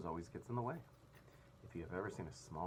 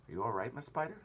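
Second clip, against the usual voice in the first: grasshopper calling down over the edge, raised voice; called out; 7 semitones higher, 5 dB louder than usual. A narrator's reading. Old grasshopper shouting down to Miss Spider, anxious call. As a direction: call down to her, loud and high. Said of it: "shouted the old green grasshopper".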